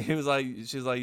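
ordinary talk, nothing marked

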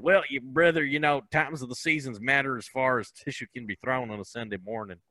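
put-on folksy Southern accent, trailing off at the end; imitated North Carolina good old boy. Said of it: "who speaks exactly like that".

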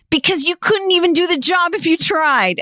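high-pitched imitation of an exasperated boss; hammed-up put-down, pitch jumped way up; mock scolding. Boss telling Ben Affleck off over the job, mock scolding.

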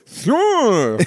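high, drawn-out, comic "sure"; goofy mock reply. Acted reply with the mouth full.

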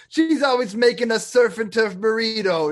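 hammed-up stand-up bit about an Italian mother; Sebastian Maniscalco. Podcaster mocks a San Diego comic bragging of his Italian ma, hammy impersonation. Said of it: "and he's like".

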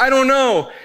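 high-pitched imitation of a bewildered kid; clueless eight-year-old. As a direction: plead ignorance like a small child. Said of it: "They're like".

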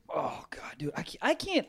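whispered disbelief. Real, not acted. Hearing about sleeping with the best friend, whispered shock. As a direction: breathe it out in shock.

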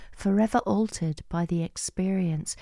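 ordinary talk

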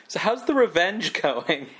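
nag, cracking up at the word revenge; laughing nagging spouse. Retold or imitated.

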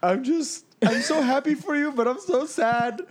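high-pitched imitation of tearful dad; hammed-up weepy whine, stretching the last words; mock crying. Dad phones his son on moving day, mock crying. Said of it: "my dad calls me crying".

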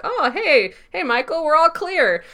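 high, loud friendly call-out; Dillahunt. Retold or imitated. Goon calls the all clear to Michael, calling out. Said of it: "a very Dillahunty voice calls out".

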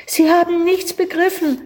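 put-on high frustrated boy's exclamation; ausgerufen. A narrator's reading. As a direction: cry it out, dismayed. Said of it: "rief der Junge aus". (hoher enttäuschter Ausruf des Jungen)

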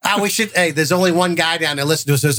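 ordinary talk, nothing marked

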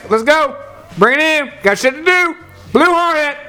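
hollering the crew in, hammed up; mock yelling. Yelling at the whole job to gather in. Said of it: "I'm yelling this shit".

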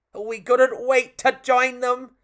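high, loud, stretched-out eagerness; excited narration. A narrator's reading. Storyteller sharing the puppet's eagerness, high and excited.

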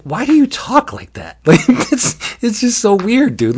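high, laughing, incredulous elf; mock bewilderment at a weird friend; baffled complaint. [name] baffled by Leonard's speech.

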